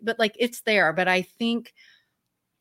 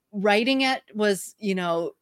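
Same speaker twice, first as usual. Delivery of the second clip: normal talking voice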